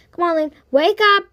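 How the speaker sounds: loud, high urgent call; urgent shout. Acted. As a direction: yell at him to wake up. Slapping friend awake.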